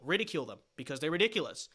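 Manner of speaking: ordinary talk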